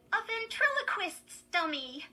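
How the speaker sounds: put-on high, loud, excited girl's voice. A narrator's reading. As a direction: show off a thrilling find. Gleeful girl shows off her find.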